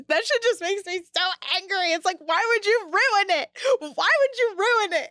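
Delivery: exasperated outburst at the ruined show; really pissed off. Real, not acted. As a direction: vent the frustration, pitch climbing. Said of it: "I got really pissed off".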